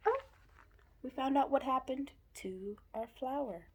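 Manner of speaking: plain reading voice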